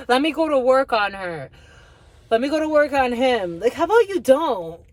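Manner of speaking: loud, high mimicry of a meddler; mock meddler rant. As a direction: push it out high and loud, mocking. Mimics meddlers, then scolds them.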